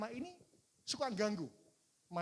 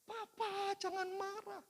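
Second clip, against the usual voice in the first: put-on small child pleading; 11 semitones higher, slower than usual. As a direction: beg like a toddler clinging to his dad. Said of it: "dia peluk saya".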